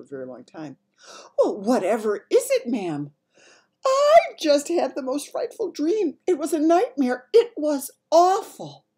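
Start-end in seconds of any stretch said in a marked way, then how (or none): 1.4-3.1 lightly voiced maid, raised and loud; concerned maid. Maid to the Queen after her cry, concerned. 3.8-8.8 high, loud and distraught royal voice; distressed Queen. Acted. Queen telling her maid about a nightmare, distressed.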